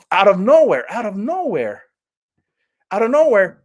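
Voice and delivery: hammed-up jolting outbursts; acted sudden interruption. Reviewer acting out the film's jarring cuts, loud bursts. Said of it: "this is a little exaggeration".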